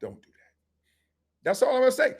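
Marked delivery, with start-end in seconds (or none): 0.0-0.5 very soft, low caution; barely voiced, low warning; quiet warning. Answering a parent who challenges him, very quiet warning.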